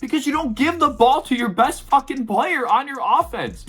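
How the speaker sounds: high, heated scolding of the coach; angry call-out. Real, not acted. Fan yelling at coach Doug Peterson, heated and high. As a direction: tear into him like he's in the room.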